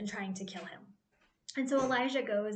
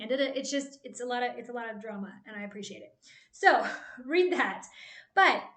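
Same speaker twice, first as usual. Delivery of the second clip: ordinary talk, nothing marked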